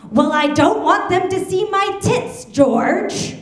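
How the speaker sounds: imitated grandmother hollering, high and loud; top-of-lungs shout. Grandmother yelling at her daughter over window shutters, mock shout. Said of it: "on the top of her lungs, my grandmother shouts".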